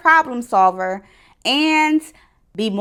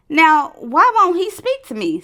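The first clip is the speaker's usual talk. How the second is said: higher-pitched wondering snake voice; puzzled python; 6 semitones higher than usual. A narrator's reading. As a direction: wonder aloud to yourself, pitched up. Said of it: "said the python to himself".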